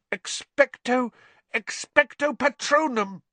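high, strained attempt at a spell; desperate incantation. A narrator's reading. Harry alone as Dementors close in, desperate incantation.

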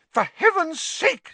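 very high, loud exasperation; hysterical. A narrator's reading. Nurse overrun by visitors, hysterical. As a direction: explode hysterically, stretching it out. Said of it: "said Madam Pumphrey hysterically".

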